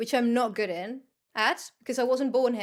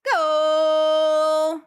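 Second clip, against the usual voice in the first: shout; 3 semitones higher, 9 dB louder than usual. Real, not acted. Cheering someone on.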